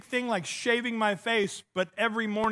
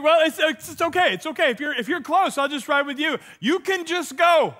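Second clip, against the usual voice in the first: higher, louder retold reply; higher pitched, louder eager reassurance; imitating himself; 7 semitones higher, 4 dB louder than usual. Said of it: "I'm like".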